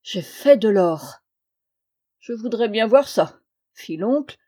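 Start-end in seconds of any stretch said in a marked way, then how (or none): 0.0-1.2 slow, weighty revelation, building louder; fateful. A narrator's reading. Nephew gripping his uncle's wrist to reveal his secret, fateful. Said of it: "d'un air fatidique". 2.2-3.4 louder, lightly jeering tone; mocking. A narrator's reading. Uncle doubting his nephew makes gold, mocking. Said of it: "d'un ton goguenard".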